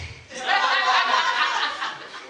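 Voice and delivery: loud high laugh breaking out; burst of laughter. Real, not acted. Pastor laughing aloud at his own story.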